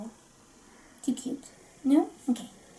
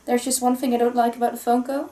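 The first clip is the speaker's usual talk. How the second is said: ordinary talk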